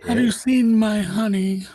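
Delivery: high, slow Pooh Bear asking; host asking the guest, as Pooh Bear. Acted. Slow high honey-hungry bear. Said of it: "Pooh impression on the show".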